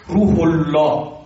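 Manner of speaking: "الله" slow and low, with a thick, full l; heavy Allah. Shown as a demonstration. Teacher showing the rule, "الله" drawn out with a heavy l. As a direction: make "الله" big and heavy after the u sound. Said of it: "بلند درشت تلفظ کنی".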